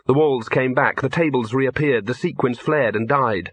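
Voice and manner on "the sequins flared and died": ordinary delivery, nothing marked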